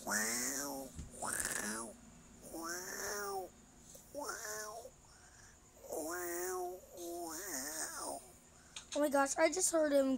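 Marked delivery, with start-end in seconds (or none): 0.1-8.2 hushed whispered wows; whispering while hiding. Real, not acted. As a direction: breathe the words, staying hidden. Waiting quietly to ambush player.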